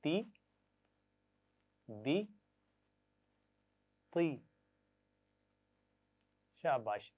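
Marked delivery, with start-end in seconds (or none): 0.0-4.4 ती, दी, ती spaced out; syllables for repeating; sound drill. Shown as a demonstration. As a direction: model each syllable. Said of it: "ये तीन आवाज़ें पढ़ेंगे".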